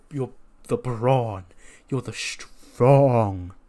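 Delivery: very slow, drawn-out words to a slow partner; slow deliberate explaining. Retold or imitated. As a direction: spell it out word by word. Keith Lee explaining slowly.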